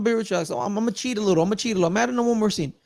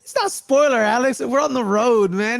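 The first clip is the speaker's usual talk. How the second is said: higher, teasing protest; playful protest; 6 semitones higher, 3 dB louder than usual. Real, not acted. Host teasing Alex that it is no spoiler, higher and playful. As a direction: brush off the complaint with a grin.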